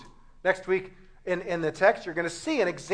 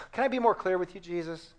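ordinary delivery

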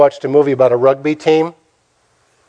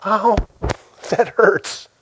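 mock wince, higher and slow; higher, softly pained yelp; pained ouch; 5 semitones higher, 4 dB quieter, slower than usual. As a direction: play at being hurt, drawing it out. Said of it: "can you just kinda go".

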